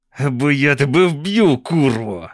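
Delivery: understated shout in a put-on voice; mild shouted threat. A narrator's reading. Benjamin threatens the narrator on the roof, mild shout. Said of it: "кричить він".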